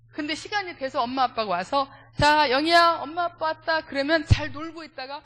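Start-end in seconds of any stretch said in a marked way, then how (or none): 2.2-3.8 put-on loud, high call to the child; parent calling out. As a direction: call across the room to your kid. (아이를 크게 부르는 부모)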